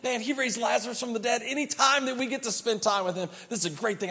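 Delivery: high-pitched, eager and fast; excited Martha. Acted. Martha, thrilled Jesus is visiting, speaks excitedly. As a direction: Martha gushing over her famous guest. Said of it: "you start out and you're really excited".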